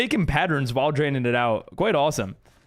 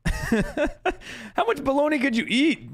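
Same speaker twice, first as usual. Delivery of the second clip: laughing; 9 semitones higher, much slower than usual. Real, not acted. Laughing at mom's bologna.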